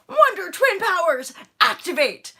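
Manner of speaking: high, loud cartoon battle cry; shouted catchphrase. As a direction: belt it like a superhero call. Quoting cartoon catchphrase playfully.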